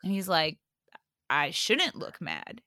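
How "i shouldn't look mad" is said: lower, softer and slow, imitating calm; easy, unbothered reassurance; calm boyfriend. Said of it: "he was, like, so calm".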